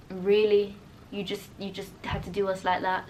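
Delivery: low, quiet, deflated disbelief; mock letdown. Viewers let down by the ending, low deflated disbelief. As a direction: act out a let-down viewer, dropping low and soft.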